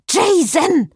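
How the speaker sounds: loud, very high screech. A narrator's reading. Cécile calls Jason for help, screeching. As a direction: shriek the name down the phone. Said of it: "kreischte Cécile".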